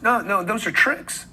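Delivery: normal talking voice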